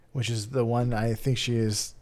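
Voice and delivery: ordinary talk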